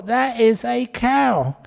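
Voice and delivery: slow, stubborn That is a cow; mock stubborn wife digging in; insistent wife.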